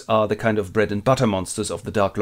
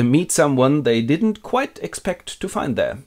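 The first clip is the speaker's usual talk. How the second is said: ordinary talk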